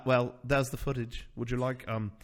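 light put-on British accent; polite, understated British delivery; young British guy. Young British friend showing wedding footage to a couple, mild British accent. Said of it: "that young British guy".

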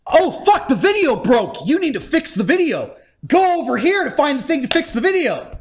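exaggerated urgent quest prompts; mock game guide. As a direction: announce each setback like a nagging game helper. Mocking game guide sending player on errands.

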